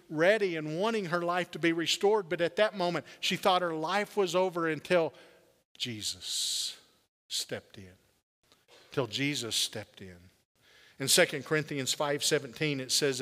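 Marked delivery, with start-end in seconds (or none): 5.8-10.2 soft, low reverent emphasis; hushed emphasis. Real, not acted. Preacher drops voice for emphasis.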